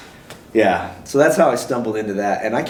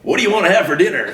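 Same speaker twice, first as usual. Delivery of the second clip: everyday question in a raised announcer voice; announcer voice at home; 8 semitones higher, 3 dB louder, faster than usual. Retold or imitated. Home from the marathon, still in a lightly voiced announcer voice. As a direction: ask about dinner like a mock stage MC. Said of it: "I can't turn the voice off".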